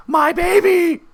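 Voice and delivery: very high falsetto cry at normal volume; imitated woman screaming. GM showing how the boogeyman screams for his baby, womanly. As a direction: wail it like a distant, hammed-up woman. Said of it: "trying to do, like, a, a woman".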